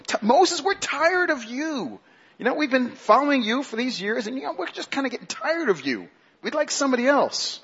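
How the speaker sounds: high whine, dragged out; hammed-up weary griping; whiny complaining. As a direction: whine at your leader like a fed-up follower. Acting Israelites complaining to Moses.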